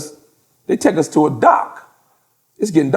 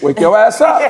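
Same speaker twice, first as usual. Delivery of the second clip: higher, louder jab to wake him; mock wake-up shout; 5 semitones higher, 4 dB louder than usual. Teller waking his sleepy friend, mock shout. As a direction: rouse a dozing buddy with a holler.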